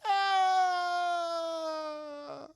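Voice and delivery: high, loud, drawn-out scream; long falling scream. Retold or imitated. Thug shoved down the air duct, long scream.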